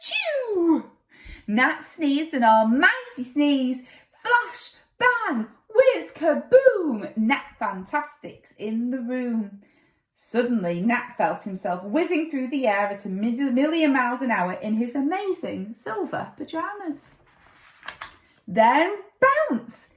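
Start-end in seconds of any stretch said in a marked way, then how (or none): 0.1-0.9 big high theatrical achoo. Acted. Narrated almighty sneeze. 4.2-7.2 high, punchy magic bang words; excited sound effects. A narrator's reading. 18.5-19.9 high punchy bump; excited exclamation. A narrator's reading. Dramatic landing.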